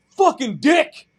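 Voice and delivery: high, loud hurled insult; imitated angry scream. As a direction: yell it like an enraged stranger. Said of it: "this weird guy who screamed at me".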